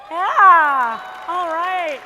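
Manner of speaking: shouted celebratory yeah; rally the room loudly; loud cheer. Real, not acted. Celebrating Chad's birthday.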